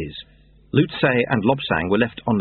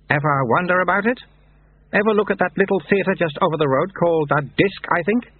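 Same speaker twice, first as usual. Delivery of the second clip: higher-pitched mentor voice; knowing Lutze; 9 semitones higher than usual. Acted. Lutze teaching Lobsang about lies, knowing. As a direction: lead the pupil along with questions.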